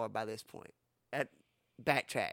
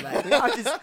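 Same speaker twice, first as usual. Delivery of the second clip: laughing; 7 semitones higher, 5 dB louder than usual. Retold or imitated. Laughing in retold plea.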